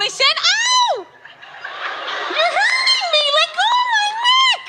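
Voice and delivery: shrill high screaming woman; hammed-up high pitched lady's scream. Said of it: "She's going".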